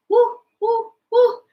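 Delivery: a mother monkey calling; mommy monkey. Shown as a demonstration. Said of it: "Mommy monkey".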